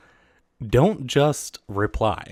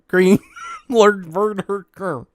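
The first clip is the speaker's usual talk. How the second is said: stumbling over words in laughter; losing it over the name; giggle-choked stumble; laughing; 10 semitones higher, 7 dB louder, slower than usual. Real, not acted.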